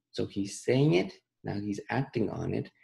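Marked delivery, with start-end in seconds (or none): none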